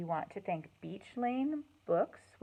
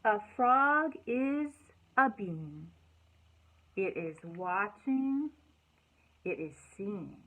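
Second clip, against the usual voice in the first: slow, loud storytime reading; expressive picture-book read-aloud; reading to children; 6 dB louder, slower than usual. Acted. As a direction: read each line out slowly to the kids.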